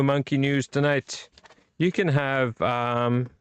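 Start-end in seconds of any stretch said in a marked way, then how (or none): none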